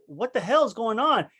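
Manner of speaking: high-pitched incredulous demand; acted disbelief; retold outrage. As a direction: demand an explanation, mildly outraged. Retold outrage at mother's betrayal.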